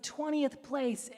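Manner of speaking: ordinary talk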